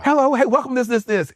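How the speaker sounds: high, bubbly hostess voice; imitating upbeat greeting. Husband mimicking wife greeting celebrities, high and bubbly. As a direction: mimic her chirpy event greeting.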